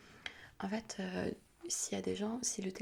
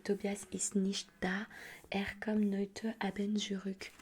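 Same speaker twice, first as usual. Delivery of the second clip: slow German phone script, a bit louder; unpractised German; 5 dB louder, much slower than usual. Shown as a demonstration. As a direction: spell out the German phrase word by word. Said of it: "je parle pas très bien allemand".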